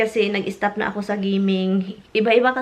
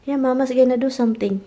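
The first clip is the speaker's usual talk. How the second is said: usual speaking voice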